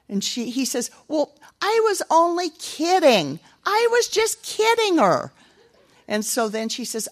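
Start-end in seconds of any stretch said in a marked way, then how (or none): 1.1-5.3 put-on high, slow sheepish child voice; whiny excuse. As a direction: wriggle out of it like a caught toddler. Boy makes excuse.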